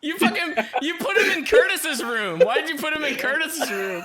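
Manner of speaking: mock fury you can't keep straight; laughing outrage. Real, not acted. Friend moved him, laughing outrage.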